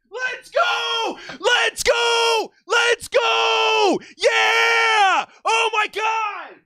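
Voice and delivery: real high-pitched shouts of celebration; ecstatic screaming. Real, not acted. Fan screams as his team drafts his favourite receiver, ecstatic. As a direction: scream it out, stretching the words. Reacts live to his team's pick.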